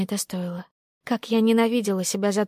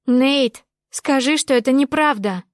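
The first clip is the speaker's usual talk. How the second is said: raised, louder and higher plea; breaking into a shout; 5 semitones higher, 5 dB louder than usual. A narrator's reading. Woman confronting Nate in his hall, breaking into a shout. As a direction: cry it out, demanding a denial. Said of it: "я сорвалась на крик".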